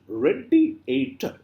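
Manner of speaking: "radiator" slow and high, in four parts; syllable by syllable. Shown as a demonstration. Teacher showing four syllables, slow syllable by syllable. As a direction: draw out each beat of "radiator". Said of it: "four syllables".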